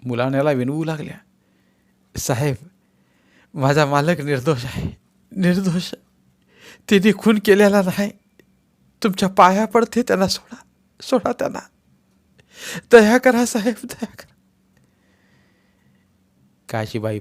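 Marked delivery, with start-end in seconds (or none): 2.2-11.6 higher, louder desperate wife begging; wife pleading with the officer, tearful and high. Acted. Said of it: "Kashibai रडू लागल्या". 12.9-13.8 higher and louder cry for mercy; wife crying out to the officer for mercy; loud plea. Acted. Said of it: "Kashibai रडू लागल्या". 13.8-14.3 broken whisper for mercy; spent, whispered begging; whispered plea. Acted. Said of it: "Kashibai रडू लागल्या".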